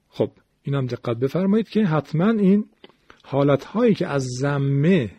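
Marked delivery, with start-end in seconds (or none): none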